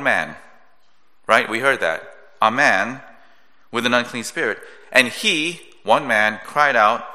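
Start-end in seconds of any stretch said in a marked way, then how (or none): 4.9-7.0 "one man" said louder and set apart; louder emphasis on "one man"; stress on one man. Shown as a demonstration. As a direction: lean on "one man" to show the mismatch. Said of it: "that doesn't go together".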